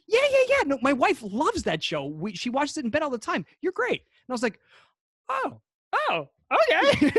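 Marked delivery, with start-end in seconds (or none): 0.1-4.0 high, gushing retold enthusiasm; eager imitation of a delighted acquaintance; excited recognition. Retold actor recognizing the guest. 5.3-7.1 high, startled little reaction; retold starstruck surprise; flattered surprise.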